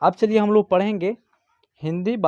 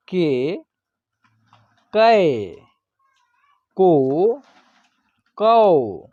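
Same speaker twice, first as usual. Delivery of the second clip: each syllable drawn out alone, with long pauses between; barakhadi recitation; slower than usual. Shown as a demonstration. Teacher reciting Hindi syllables to students as a slow classroom chant. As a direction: chant the letters slowly for children to repeat.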